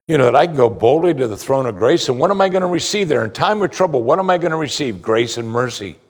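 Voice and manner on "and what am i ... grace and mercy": usual speaking voice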